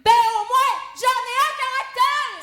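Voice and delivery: loud, high comeback; angry retort. Acted. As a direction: snap back at full volume in a squabble. Acted quarrel, angry retort.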